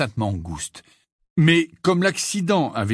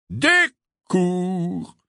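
slow, higher-pitched puzzled echo; slightly disconcerted; 7 semitones higher, 3 dB louder than usual. A narrator's reading. Lockhart hears he used to give lessons, disconcerted. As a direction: repeat it blankly, drawn out. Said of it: "répéta Lockhart un peu déconcerté".

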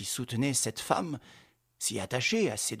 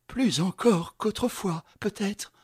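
pleading wife voice; 8 semitones higher, slower than usual. A narrator's reading. Wife finishing her plea.

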